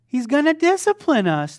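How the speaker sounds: shouted answer. Real, not acted. Preacher answering his own call with the congregation, loud. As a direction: belt the answer with the crowd. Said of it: "Shout it out".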